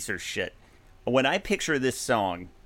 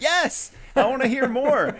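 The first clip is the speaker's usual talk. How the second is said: eager crowd; 12 semitones higher, 4 dB louder than usual. Retold or imitated. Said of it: "They're like".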